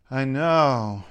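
"I know" drawn out, sinking in pitch; varied intonation. Shown as a demonstration. Linguist showing how intonation changes meaning, drawn-out falling "I know". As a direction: stretch "know" long and let it fall. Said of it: "your intonation can make a huge difference".

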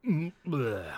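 grossed-out "bleh"; disgusted by taste. Retold or imitated. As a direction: gag at something gross. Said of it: "And I immediately just went".

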